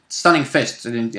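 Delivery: ordinary talk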